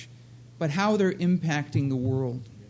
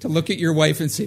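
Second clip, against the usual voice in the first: ordinary talk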